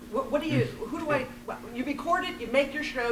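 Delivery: ordinary talk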